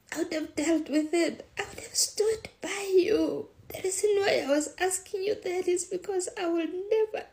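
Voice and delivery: very high, loud, distraught pleading; anguished outburst. Retold or imitated. Mother telling her daughter she'd have protected her, anguished. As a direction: pour it out at the top of the voice, overwhelmed.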